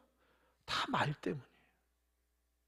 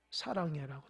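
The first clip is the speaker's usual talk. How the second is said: soft echo; 5 semitones lower, 7 dB quieter than usual. A narrator's reading. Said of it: "뭐라고 들리죠?".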